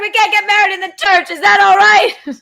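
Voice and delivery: high, loud put-on voice; mock old-time voice. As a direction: ham it up in a theatrical voice. Mock announcement to partner.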